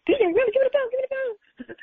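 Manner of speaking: high, fast and eager; snatch for the phone, thrilled; high quick excited retelling; retold eager excitement.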